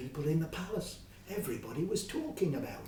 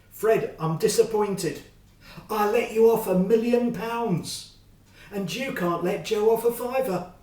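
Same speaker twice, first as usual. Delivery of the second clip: stern king; 6 semitones higher, 11 dB louder than usual. Acted. King rebukes Fred.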